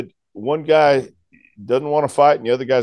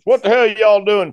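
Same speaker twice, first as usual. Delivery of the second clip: louder, higher mock cop yell; cop's yell; 7 semitones higher, 5 dB louder than usual. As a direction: bark it out like a cop arriving. Cop arrives at roadside.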